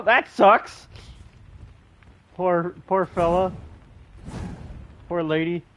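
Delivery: ordinary talk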